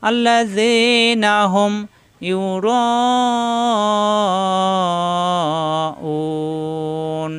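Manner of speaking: slow, drawn-out chanted recitation; Quran recitation. Shown as a demonstration. Teacher reciting Quran verse for students, slow chant. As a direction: recite with long tajweed stretches.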